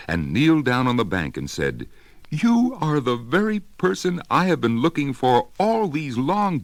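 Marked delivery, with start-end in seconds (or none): 2.3-6.6 put-on breathless eager child. A narrator's reading. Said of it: "grew all breathless and panted".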